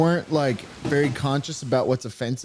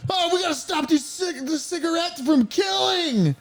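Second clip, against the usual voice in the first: frantic cartoon cells; 14 semitones higher than usual. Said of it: "they're like".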